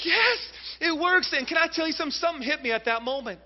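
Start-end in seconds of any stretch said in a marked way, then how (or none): 0.0-1.3 high, loud triumphant yes; exultant cry. Retold or imitated. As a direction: celebrate in a squealing high voice. Father exulting at lessons paying off.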